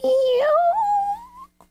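tiny high cat meow; meek meow. Retold or imitated. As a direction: mew like a timid cat. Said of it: "it makes a meek meow".

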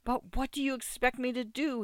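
exasperated outburst. A narrator's reading. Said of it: "he burst out exasperatedly".

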